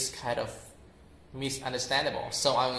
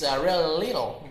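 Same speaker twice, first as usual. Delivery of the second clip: usual speaking voice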